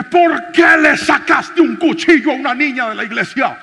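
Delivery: loud, high, outraged yelling; furious shout at thief. Retold or imitated. Pastor yelling at the thief who pulled a knife, furious. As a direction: bellow the accusation at the thief.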